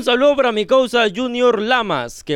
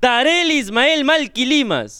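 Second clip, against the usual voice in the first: slow, higher announcer read; donor announcement; 4 semitones higher, slower than usual. Real, not acted. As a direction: call out the name like an MC. Anuncia el nombre de un donante. (nombre anunciado lento y más agudo)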